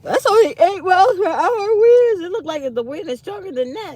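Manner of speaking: high loud disbelief; incredulous. Real, not acted. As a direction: squeal it in disbelief. Amazed at wind speed.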